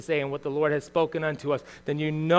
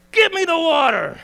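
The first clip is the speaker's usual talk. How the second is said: angry demand; 10 semitones higher, 6 dB louder than usual. Retold or imitated. Moses rants at the people.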